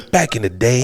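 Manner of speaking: lightly imitated old smooth talker; lightly voiced Mister Johnson. Older patient recalling his glory days, lightly put-on voice. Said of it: "let me get the voice down properly".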